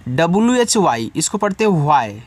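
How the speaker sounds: usual speaking voice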